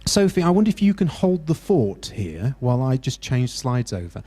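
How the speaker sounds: ordinary talk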